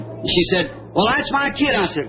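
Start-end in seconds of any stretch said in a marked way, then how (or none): 0.9-1.7 higher-pitched imitation of a wife's objection; protesting. Wife refusing to hand daughter over to God, mock protest. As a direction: object in a raised, possessive voice.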